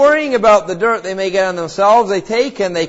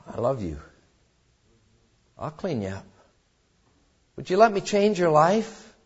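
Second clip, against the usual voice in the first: much softer, low and gentle; God's tender voice; 3 semitones lower, 7 dB quieter than usual. Retold or imitated. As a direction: speak God's love softly, like a quiet promise. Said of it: "God in His love said to you and I".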